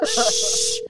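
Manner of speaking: long, strong shush. Real, not acted. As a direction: hush the room before the call. Hushing others before a phone call.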